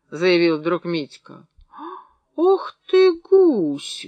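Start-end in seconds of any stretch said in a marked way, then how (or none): 2.4-4.1 high, loud mock-threatening outburst; menacing exclamation. A narrator's reading. Cleaner rebuking cheeky Mitka, menacing. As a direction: swoop up high and scold him. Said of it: "грозно сказала женщина".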